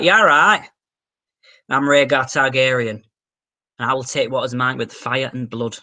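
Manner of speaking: Northern English prince, broad vowels; fantasy vow in a plain-spoken Northern accent. Acted. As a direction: put on a comic Northern accent. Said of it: "Be funny if he was Northern".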